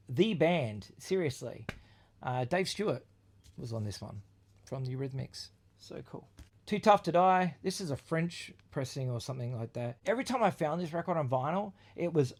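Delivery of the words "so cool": breathless whisper of admiration; whispered awe. Real, not acted. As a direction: hush it like a secret treasure. Whispered aside about the record.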